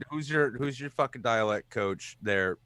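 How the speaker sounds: normal talking voice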